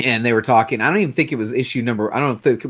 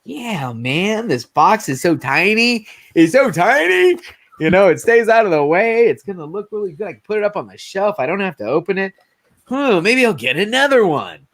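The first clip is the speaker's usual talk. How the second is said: high-pitched, tickled collector talking himself into it; hammed-up delighted musing; gushing excitement; 7 semitones higher than usual. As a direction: gush over it, pitch swooping up. Acting out delight at tiny box.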